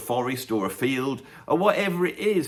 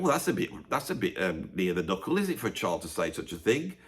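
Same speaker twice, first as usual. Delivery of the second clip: softer, fast, prissy British voice; mock prim British tut, quieter; prim British adult; 7 semitones lower, 7 dB quieter than usual. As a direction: lightly play a scandalised Brit. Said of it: "the British people are going".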